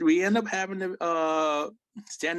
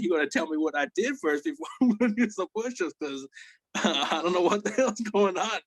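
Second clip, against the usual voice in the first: high-pitched, half-laughing retold objection; mock bewildered complaint; baffled protest; 6 semitones higher than usual. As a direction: argue back in a raised pitch, replaying it. Retold protest, arguing back.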